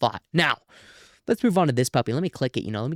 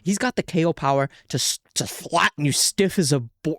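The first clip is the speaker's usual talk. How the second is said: ordinary talk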